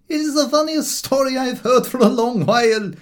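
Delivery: enjoy the story out loud; amused landlord. Acted. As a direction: landlord delighted by the dog story.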